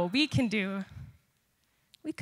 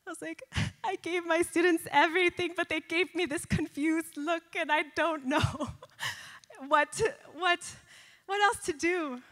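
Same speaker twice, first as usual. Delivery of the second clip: retold crying plea; 7 semitones higher than usual. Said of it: "I started crying".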